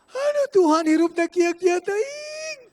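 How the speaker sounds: loud, high, drawn-out wail; hammed-up weepy lament; mock tearful prayer. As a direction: imitate someone crying to God over troubles. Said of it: "menyembah sambil nangis".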